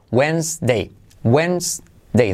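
"Wednesday" as "wens-day"; silent D. Shown as a demonstration. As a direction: skip the first D in "Wednesday", clearly modelled. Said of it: "la D, no hay que pronunciarla". ("Wednesday" sin la primera D)

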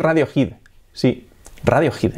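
"Radiohead" as RA-dio-jid; Spanish-style ra-dio-HID with raspy j; Spanish pronunciation. Shown as a demonstration. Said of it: "se dice aquí Radiohead".

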